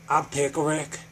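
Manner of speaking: ordinary talk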